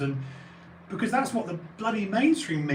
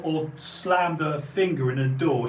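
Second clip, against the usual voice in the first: normal talking voice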